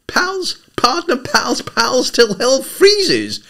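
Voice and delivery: high, slowed hammed-up cowboy oath; deeply moved. A narrator's reading. Freddie quoting a western to Eve, hammed-up vow. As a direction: ham up a silent-film subtitle. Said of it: "deeply moved".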